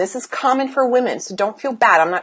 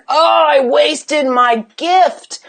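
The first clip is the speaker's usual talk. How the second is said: drawn-out, raised lament; regretful ninety-year-old; 4 semitones higher, much slower than usual. Acted. Coach voicing a listener's regret at ninety, mock lament. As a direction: wail it slowly, mock regret. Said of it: "and you think".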